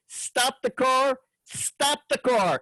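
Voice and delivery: high, drawn-out shout without real volume; mock yell. A narrator's reading. Woman outside the diner to a passing driver, yelling. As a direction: stretch each word high, as if hailing a car. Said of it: "I hear Ollie yelling".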